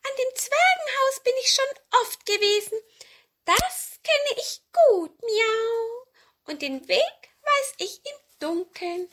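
very high, loud cat voice; storybook cat, high-pitched and emphatic; old cat. Acted. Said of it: "sagte die Katze Murks".

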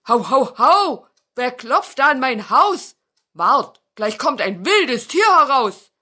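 loud, high barking beast; barking threat from inside. Acted. Fox behind the iron door scares visitors, loud barking. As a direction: bark out a fierce warning. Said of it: "Da bellte es von drinnen".

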